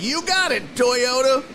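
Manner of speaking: high-pitched mock jingle voice; imitating mom's catchphrase. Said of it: "She'd be like".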